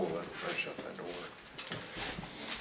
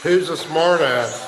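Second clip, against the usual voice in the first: loud, higher-pitched challenge; challenging call; 5 semitones higher, 8 dB louder than usual. Real, not acted. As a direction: call out to whoever is hiding. Challenging an unseen spirit aloud.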